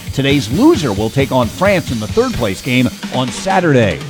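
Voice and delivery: usual speaking voice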